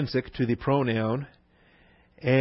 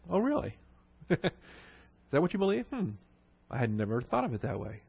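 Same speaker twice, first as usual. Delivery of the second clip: softer, mock-innocent "Oh, really?" with a little laugh; feigned surprise; 9 dB quieter than usual. Shown as a demonstration. Teacher acting a curious questioner to a skeptic, feigned surprise. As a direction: play dumb, gently probing.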